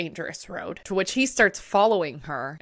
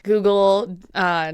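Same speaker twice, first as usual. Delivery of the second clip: ordinary talk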